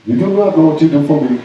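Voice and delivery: put-on over-the-top South African accent; mock South African accent. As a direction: parody Will Smith's South African accent. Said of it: "The South African accent".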